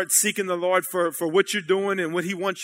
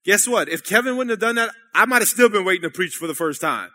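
normal talking voice

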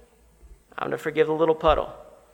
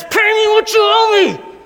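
high strained shout, pleading and furious; desperate demand; 8 dB louder than usual. Retold or imitated. Man choking his debtor, desperate shouting demand. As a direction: cry out in desperation for the money. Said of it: "begins to choke him and says".

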